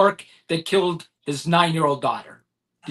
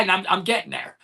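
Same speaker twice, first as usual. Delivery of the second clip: normal talking voice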